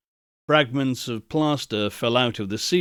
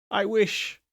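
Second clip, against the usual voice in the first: softer, a mild mutter; 5 semitones higher, 5 dB quieter than usual. A narrator's reading. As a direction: mumble it to yourself. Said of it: "Scrooge muttered".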